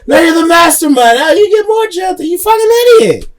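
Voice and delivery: playful shouting. Real, not acted. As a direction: yell it at your buddy, half laughing. Friends mock-scolding each other.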